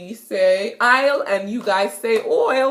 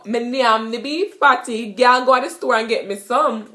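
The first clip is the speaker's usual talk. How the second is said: louder Jamaican patois; 3 semitones higher, 3 dB louder than usual. Shown as a demonstration. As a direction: tell a girl to fetch patties, in patois.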